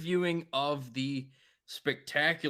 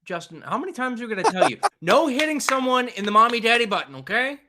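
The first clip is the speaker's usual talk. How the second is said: fast, high, louder mock teacher scolding; mock scolding parent; 7 semitones higher, 4 dB louder, faster than usual. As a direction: scold him like a kid, half laughing.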